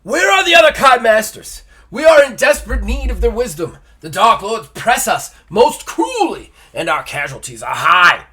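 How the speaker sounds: high, loud, theatrical plea; desperate sergeant. A narrator's reading. Sergeant begging a Kai lord for help, desperate shout. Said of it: "says the sergeant".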